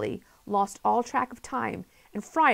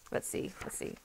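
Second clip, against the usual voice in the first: whispered aside; 11 dB quieter, faster than usual. Real, not acted. Looking for the picture, whispering to himself. Narrator searching the page.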